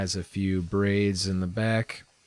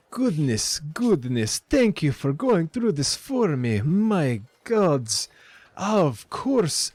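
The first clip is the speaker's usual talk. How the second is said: put-on high, flustered accented shopkeeper; shopkeeper Lenine, grateful; 6 semitones higher than usual. Shopkeeper learns lost shipment is back, flustered accented gratitude. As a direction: gush thanks in a higher accented voice, tripping over words.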